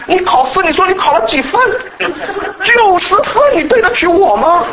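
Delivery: put-on guilt-trip the child over the exam score; imitating angry parent. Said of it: "爸妈怎么跟我说". (模仿父母责备孩子考试分数)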